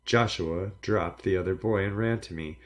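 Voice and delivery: plain reading voice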